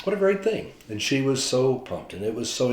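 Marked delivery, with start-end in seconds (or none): 0.1-0.7 high, bright burst of joy; delighted exclaim. Real, not acted. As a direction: gush over the good news. Preacher delights in the story.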